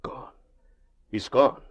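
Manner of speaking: finding the servant gone, alarmed; alarmed realisation. Acted. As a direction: blurt it out in alarm. Discovers the servant missing.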